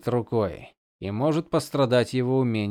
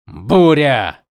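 loud angry curse; swearing; 2 semitones higher, 9 dB louder than usual. Acted. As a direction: spit out the curse loudly. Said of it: "выругался Каладин".

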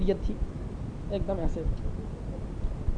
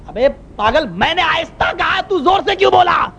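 imitating exasperated imam; 8 semitones higher than usual. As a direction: climb higher as you accuse. Imam calls him crazy, accuses him.

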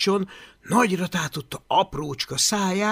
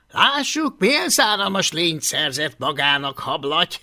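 mocking bully Takonypóc; 5 dB louder than usual. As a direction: jeer at him from above. Takonypóc gúnyolódik. (magas, gúnyos kamaszhang)

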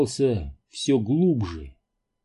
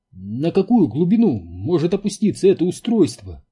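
higher-pitched eager question; excited question; 5 semitones higher, 3 dB louder than usual. A narrator's reading. Энди asks about the device's depth, excited. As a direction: ask it with keen, nervous interest. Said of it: "взволнованно поинтересовался Энди".

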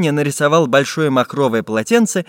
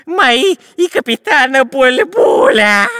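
very high, slow, stretched-out shriek; inhuman scream; 13 semitones higher, 5 dB louder, slower than usual. A narrator's reading. Spy claiming the treasure for himself and captain, screaming. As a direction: shriek possessively, dragging out the words. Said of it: "нечеловеческим голосом закричал шпион Дырка".

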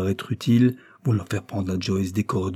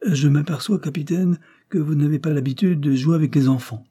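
higher and louder, a firm rebuke; exclaimed; 7 semitones higher, 3 dB louder than usual. Acted. Father rebukes the captain for handling his daughter, exclaiming. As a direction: call out the captain with authority. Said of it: "s'écria Carlyle".

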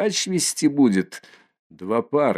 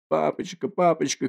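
put-on child's cry; 6 semitones higher, 3 dB quieter than usual. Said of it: "кричит он отцу".

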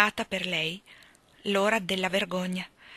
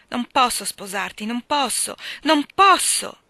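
building from firm to a loud, high cry on the last non posso; wild cry; 4 semitones higher, 6 dB louder than usual. A narrator's reading. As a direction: let it burst out, louder each time. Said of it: "gridò con impeto selvaggio".

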